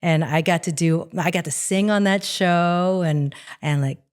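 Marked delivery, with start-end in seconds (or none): none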